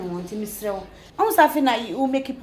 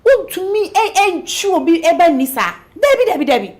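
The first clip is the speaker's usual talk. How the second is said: outraged exclaiming; 6 semitones higher, 8 dB louder than usual. Acted. Friend outraged at Dave's betrayal.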